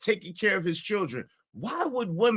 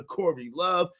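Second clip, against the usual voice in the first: normal talking voice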